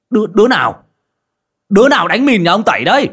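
high, loud, fast shouting; roused from bed and hollering; angry yell. Acted. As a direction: bellow at the unseen culprit. Neighbour yelling with torch.

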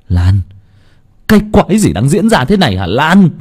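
betrayed fiancé crying out; anguished shout. Acted. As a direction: demand answers in shock. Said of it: "anh gào lên".

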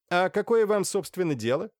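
high, pointed retort; affronted challenge. A narrator's reading. Naledinsky challenges his questioners, affronted.

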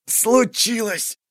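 a high, slow, strained wheeze squeezed out in pain; hoarse wheeze. A narrator's reading. Captain in pain wheezes a reply to Tern, hoarse and strained. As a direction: force the word out through a seized chest. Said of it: "просипел Кайфат".

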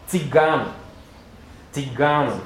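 "tzigano" with a crisp ts at the start; "tzigano", starting with a firm ts, not an s; correct Esperanto word. Shown as a demonstration. Teacher correcting how students say tzigano. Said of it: "la pronunciación es".

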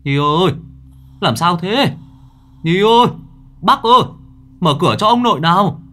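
urgent shouting to get the door opened; anxious calling. A narrator's reading. Worried grandfather shouting frantically through the door. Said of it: "sốt ruột gọi rối rít".

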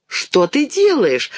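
high, drawn-out indignant question; indignant child, slow and high. A narrator's reading. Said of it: "возмутилась Динка".

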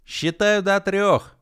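high, loud taunt; little man's shout. A narrator's reading. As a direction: shout a triumphant ultimatum. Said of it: "крикнул человечек".

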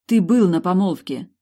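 loud, pointed retort; firm retort. A narrator's reading. The heroine confronts Sorin, loud and pointed. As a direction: press it on him hard.

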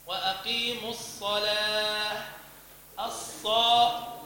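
slow recited الصلاة with a full heavy ص; heavy sad recitation. Shown as a demonstration. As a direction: recite with a thick ص. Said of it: "صاد مفخمة". (ترتيل بتفخيم الصاد)

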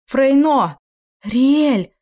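high, loud desperate call; shouting. A narrator's reading. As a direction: cry out both names. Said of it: "выкрикнула она".

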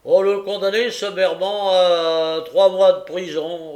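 loud, high proclamation; imperious sentencing. A narrator's reading. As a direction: pronounce sentence with a flourish. Magistrate pronounces summary sentence.

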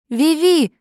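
high loud terrified cry; piercing scream. A narrator's reading. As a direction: scream her friend's name. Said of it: "пронзительный возглас Оливии". (пронзительный крик)

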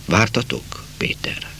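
whispered, voiceless remembered question. Acted. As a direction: breathe the question like a memory. Remembered voice leaning down. (suttogva, emlékként)

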